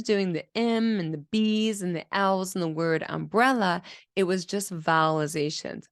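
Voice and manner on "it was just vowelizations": normal talking voice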